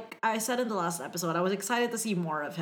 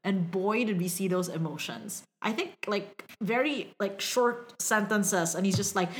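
ordinary talk

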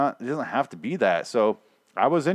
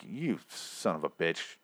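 low, slow, much softer curse; hushed mock outrage; 6 semitones lower, 12 dB quieter, slower than usual. Viewer cursing Rick over the wasted tomato, hushed mock outrage. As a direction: mutter it slowly, under your breath.

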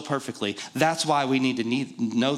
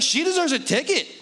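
laughing; 11 semitones higher than usual. Retold or imitated. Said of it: "I was like".

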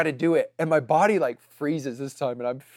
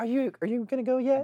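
put-on higher, quick squeaky little-boy nagging; imitating a little boy pestering; squeaky kid voice; 10 semitones higher, faster than usual. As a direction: rush it like an impatient small kid. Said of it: "with this little squeaky voice".

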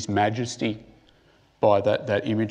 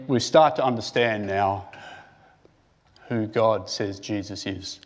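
normal talking voice

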